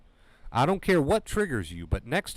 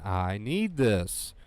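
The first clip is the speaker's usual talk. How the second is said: slow it down, let every word land; slow, deliberate affirmation; much slower than usual. Shown as a demonstration. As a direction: stretch out each word of "I need this". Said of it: "those three words again".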